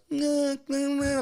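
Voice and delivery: very high, strained "nah" sounds; imitated wordless noises. Storyteller imitating the man who couldn't hear, high wordless noises. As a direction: imitate high inarticulate vocalizing. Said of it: "he goes like this".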